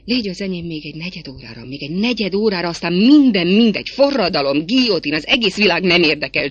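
quick pleading building to loud outburst; passionate pleading. A narrator's reading. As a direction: rush it, then throw the world away loudly. Lover begs for a quarter hour. (sürgető, egyre hangosabb könyörgés)

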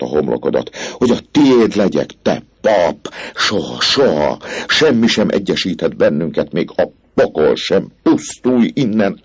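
loud, high, raging screams; girl screams the priest away in rage; furious. Acted. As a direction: drive him out like a tigress. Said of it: "mint egy dühött nőstény tigris".

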